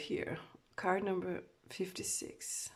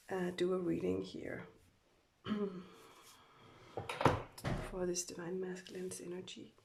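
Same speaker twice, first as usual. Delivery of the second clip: ordinary talk, nothing marked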